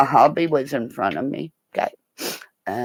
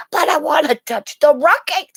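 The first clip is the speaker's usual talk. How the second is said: high, loud pleading whine; childish protest; 12 semitones higher, 5 dB louder than usual. Retold or imitated. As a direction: whine back like a thwarted kid. Acted childish protest to officer.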